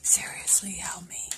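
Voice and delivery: fast urgent whisper. Pretend. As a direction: whisper a secret plea quickly. Mock plea whispered to viewers.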